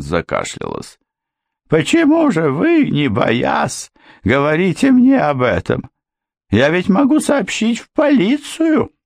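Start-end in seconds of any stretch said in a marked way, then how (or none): 1.7-8.9 put-on high, defensive older woman's voice; alarmed housekeeper. A narrator's reading. Housekeeper challenging the girl, high alarmed voice. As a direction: play the cornered housekeeper, pitched up.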